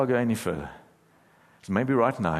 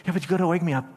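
imitating pleading daughter; 8 semitones higher, much faster than usual. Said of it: "I'm like".

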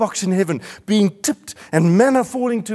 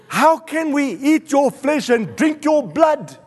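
raised pitch, driving and emphatic; impassioned preaching; 6 semitones higher than usual. Real, not acted. Preacher telling congregation the gospel is scandalous, impassioned preaching. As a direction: preach it with mounting urgency.

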